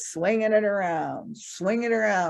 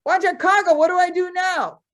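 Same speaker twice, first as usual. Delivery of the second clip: desperate plea; 9 semitones higher, 6 dB louder than usual. Acted. Man stuck, calling for help.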